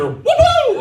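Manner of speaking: very high gleeful whoop; high-pitched cheer, played for laughs; mock celebration. Mock celebration of father's illness.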